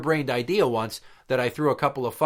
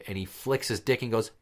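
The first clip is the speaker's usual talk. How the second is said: ordinary talk, nothing marked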